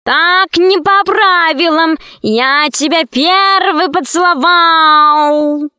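high, very drawn-out cat wail; protesting cat cry. A narrator's reading. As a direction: yowl the complaint after them, stretching the vowels. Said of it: "закричал вслед кот". (обиженный кошачий вопль)

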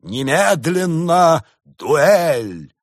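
loud, very slow duel demand; furious challenge. A narrator's reading. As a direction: bellow the challenge, spaced out. (гневный вызов, громко и медленно)

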